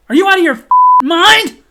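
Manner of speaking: loud, high disbelieving shout; incredulous yell. Retold or imitated. Customer to the manager over tipping, incredulous shouting. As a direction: blow up in disbelief.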